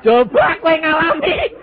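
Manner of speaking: challenging the crowd. Real, not acted. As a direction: dare them to try it.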